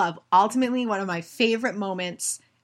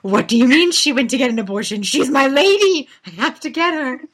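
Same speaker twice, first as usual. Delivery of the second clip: higher, louder fierce protector; mock furious knight, higher and louder; hulked-out Mary; 7 semitones higher, 8 dB louder than usual. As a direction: charge in to save her lady. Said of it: "she, like, hulks into".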